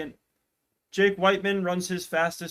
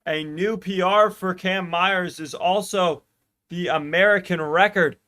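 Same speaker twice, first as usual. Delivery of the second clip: rising play-by-play, louder toward the finish; live race call; 3 semitones higher, 6 dB louder than usual. Acted. Streamer calling the 1500 meters finish live, building excitement. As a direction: call the final laps as they build.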